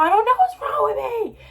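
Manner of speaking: high, loud panicked yell. Retold or imitated. Girl yelling on the phone to her mother from the Uber, high and panicked. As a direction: cry out in panic. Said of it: "I was yelling on the phone".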